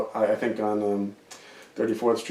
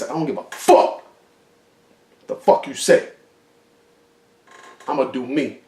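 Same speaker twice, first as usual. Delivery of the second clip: in-character anger; 3 semitones higher, 4 dB louder than usual. Pretend. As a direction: spit it at the director, defiant. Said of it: "I was, like, in character".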